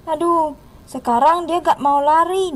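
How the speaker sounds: ordinary delivery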